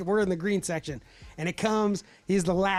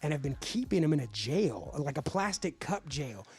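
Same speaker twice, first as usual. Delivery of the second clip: low, softer ominous voice; lightly voiced customer, low and grave; stern warning; 5 semitones lower, 6 dB quieter than usual. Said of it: "the guy stops me".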